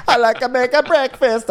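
high, put-on Jamaican lilt, half-joking; a hammed-up Jamaican accent, pitched high; wonky Jamaican accent. Imitating a reality star in a wonky, high Jamaican accent. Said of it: "my Jamaican accent is all wonky".

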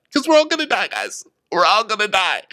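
high, melodramatic mimicry; Vicky. Telling the girls they'll die, high mock wail. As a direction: wail it up high, then fall. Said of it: "clips of Vicky like".

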